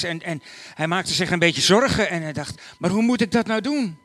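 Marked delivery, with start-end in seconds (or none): 2.8-4.0 higher, louder anxious question; fretting out loud; worried cry. Acted. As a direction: Joseph fretting about the trip. Joseph worries aloud.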